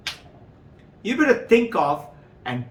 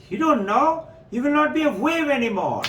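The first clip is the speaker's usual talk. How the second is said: incredulous friend; 9 semitones higher, faster than usual. Acted. Friend wave warns of death.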